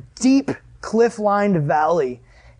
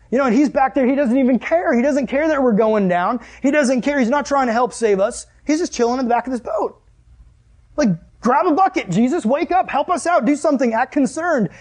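higher-pitched indignant rant; exasperated complaint; 5 semitones higher than usual. Acted. Disciples to sleeping Jesus in storm, exasperated. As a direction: act out a fed-up crew, building to pleas.

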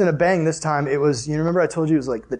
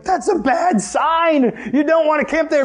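high, drawn-out warning; mock alarm; 8 semitones higher, slower than usual. Family seeing every single campsite is empty, mock alarm. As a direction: protest in a raised, worried pitch.

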